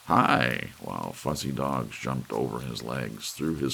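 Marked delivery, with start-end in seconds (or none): 0.1-0.7 louder bright showman's greeting; a touch of a chirpy entertainer's hello; cheery call. Acted. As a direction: call it out like a game-show host. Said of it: "expect him to call".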